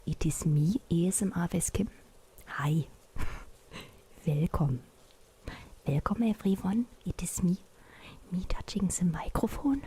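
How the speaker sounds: hammed-up German-accented stream welcome; overdone German accent. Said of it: "I cannot do a German accent".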